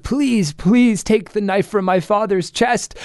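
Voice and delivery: put-on high, pleading child voice; pleading demon son. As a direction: beg desperately in a high voice. Demon son begs witch.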